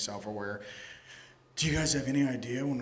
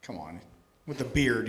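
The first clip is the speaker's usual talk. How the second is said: ordinary talk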